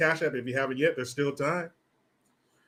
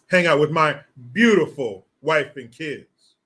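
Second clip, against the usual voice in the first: ordinary delivery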